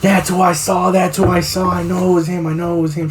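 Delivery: high-pitched frantic insistence, imitated; mom panicking. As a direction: act out a panicked mother repeating herself urgently. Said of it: "My mom fucking panicked".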